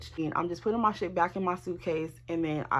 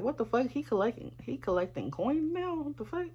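incredulous; 4 semitones higher, 7 dB quieter than usual. Retold or imitated. Baffled at coins in bag.

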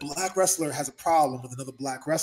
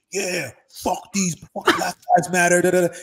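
mock Trump supporter; 2 semitones higher, 7 dB louder than usual. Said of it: "And they're like".

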